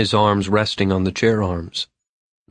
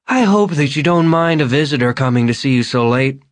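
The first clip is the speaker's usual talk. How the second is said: high, louder, oddly polite voice; overly gracious drunk; unsteady Vern; 6 semitones higher, 4 dB louder than usual. Acted. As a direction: late visitor excusing himself. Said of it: "walked unsteadily".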